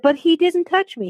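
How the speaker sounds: high-pitched pleading objection; recalled plea, pitch jumping up; retold protest. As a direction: re-enact a child protesting an accusation. Retold child's protest to priest.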